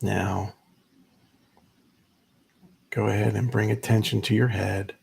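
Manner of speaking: unhurried meditation guide, long pauses between phrases; measured, spacious guiding voice; guided meditation. Acted. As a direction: ease listeners into stillness, leaving space after each instruction.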